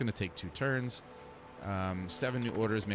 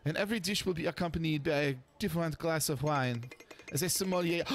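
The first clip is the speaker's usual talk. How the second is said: put-on French accent; 7 semitones higher than usual. Sophie voice continues.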